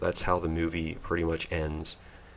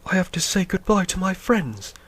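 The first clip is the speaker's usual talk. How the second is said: much higher, prissy robot voice; mock C-3PO; 13 semitones higher, 3 dB louder than usual. Mocking C-3PO's farewell, high and fussy. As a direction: fuss it like a fretful droid. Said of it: "C three P O".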